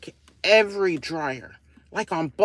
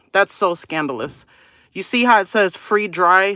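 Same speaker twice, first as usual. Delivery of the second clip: ordinary talk, nothing marked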